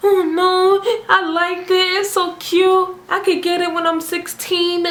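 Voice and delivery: high, put-on girlish pleading; pleading younger self. Teen self pleading with aunts.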